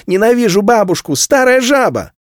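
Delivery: put-on high, loud little girl's spiteful outburst; blurted outburst. A narrator's reading. A girl bursts out against her grandmother, high and loud. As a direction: blurt it out like a furious small girl. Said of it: "а Цилла выпалила".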